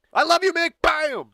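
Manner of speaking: high, loud mock yell; mock fan yell. Fan shouting before punching Mick, loud.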